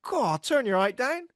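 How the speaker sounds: high, slow mock complaint; imitated friend's heckle. Friend heckling someone tall, high mock complaint. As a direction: put on a friend's high-pitched grumble. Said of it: "he, he goes".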